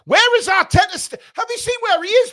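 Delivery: high teasing call; mock outrage at the manager; excited. Real, not acted. Mocking missing manager.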